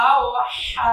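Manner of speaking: আও হা with a squeezed-throat h; throat-pressed pronunciation. Shown as a demonstration. Teacher shows learners the letter, throat pressed in আও হা. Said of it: "গলা চাপ দিয়ে বলতে হবে".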